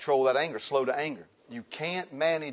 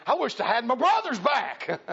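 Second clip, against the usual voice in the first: higher, louder mock whine of a frazzled husband; whining husband; 10 semitones higher, 5 dB louder than usual. Husband mocking his life to the congregation, higher whine. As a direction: play the fed-up new husband wishing for home. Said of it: "You're like".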